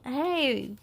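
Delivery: plain reading voice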